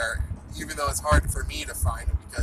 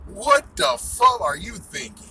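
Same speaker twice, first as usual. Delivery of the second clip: mock angry; 7 semitones higher, 6 dB louder than usual. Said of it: "They all gonna look at you like".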